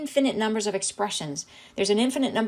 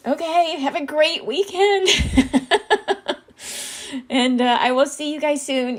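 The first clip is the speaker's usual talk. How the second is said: laughing goodbye; 8 semitones higher, 5 dB louder than usual. Real, not acted. Tearful sign-off.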